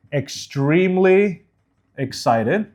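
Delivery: "extremely excited" slowly, syllable by syllable; sounded out. Shown as a demonstration. Coach showing enunciation, "extremely excited" sounded out slowly. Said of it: "to sound it out".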